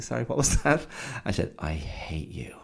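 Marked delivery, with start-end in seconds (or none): 0.0-0.9 light, high, innocent asking; harmless-sounding retold question; non-confrontational query. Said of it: "I wasn't being all confrontational". 1.6-2.5 whispered under the breath; hushed, voiceless, soft retort; retold muttering. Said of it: "muttered something".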